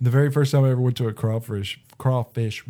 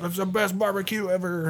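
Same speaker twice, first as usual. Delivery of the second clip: boss raving; 8 semitones higher, 4 dB quieter than usual. Retold or imitated. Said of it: "my boss is like".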